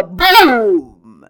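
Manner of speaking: a sudden loud burst; explosive shout; loud boom. Acted. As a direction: slam the word out like a cymbal crash. Climax of drum crescendo.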